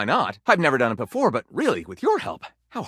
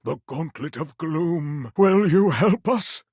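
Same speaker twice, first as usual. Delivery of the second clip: pleading wizard; 6 semitones higher than usual. A narrator's reading. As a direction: beg for the hero's help. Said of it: "Gondorf pleaded".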